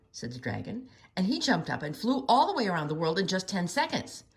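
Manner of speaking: usual speaking voice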